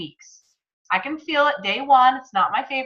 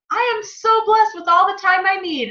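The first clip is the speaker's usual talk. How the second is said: much higher and louder, forced cheer; fake positive affirmation; 10 semitones higher, 5 dB louder than usual. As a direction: recite it with hollow brightness. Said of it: "Or if I would have just said".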